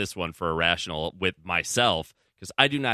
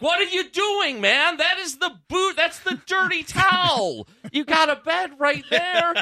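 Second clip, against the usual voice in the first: way higher, louder scolding of the dog; exasperated scolding; 4 dB louder than usual. Retold or imitated. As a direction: scold the dog in a shrieking pitch. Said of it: "And I'm like".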